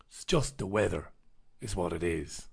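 low, soft, dismissive aside; deadpan deflation. Real, not acted. Host dismisses the headline's panic, low deadpan. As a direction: drop right down to puncture the hype. Deflates the mock alarm just read.

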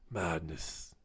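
very low, soft voice; low muttered disbelief. Real, not acted. Ranting about passport tender, low muttered disbelief. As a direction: say it low and quiet.

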